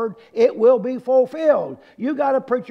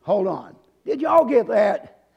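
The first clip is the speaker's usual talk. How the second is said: usual speaking voice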